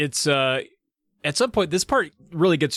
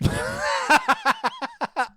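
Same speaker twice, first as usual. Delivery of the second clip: high laugh. Real, not acted. Laughing at Top Stash.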